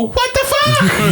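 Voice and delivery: very high, drawn-out disbelief; mock-stunned squeal, stretched out slowly; retold shock. Reacting to the celebrity phone call, high slow shock.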